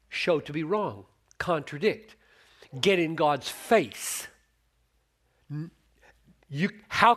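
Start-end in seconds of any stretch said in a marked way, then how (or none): 2.8-4.3 "get in God's face" को धीमे और ज़ोर से बोलना; each word slow and heavy, pressed hard; slow emphatic build. Shown as a demonstration.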